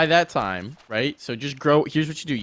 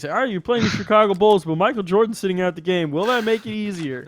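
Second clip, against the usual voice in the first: high, loud hammed-up reporter; mock announcer; 8 semitones higher, 6 dB louder than usual. Friend mocking the easy match as a reporter, loud and high. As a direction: play a press reporter asking the obvious. Mock sports broadcaster question.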